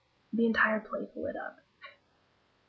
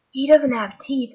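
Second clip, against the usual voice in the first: put-on loud, slow childish blurt; child blurting loudly; 4 semitones higher, 9 dB louder, slower than usual. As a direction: blurt it out like a little kid. Child blurts about toothless man.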